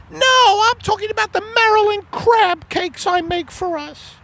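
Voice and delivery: very high falsetto wife voice; a high put-on wife, puzzled; Margaret voice. Acted couple skit, wife voice.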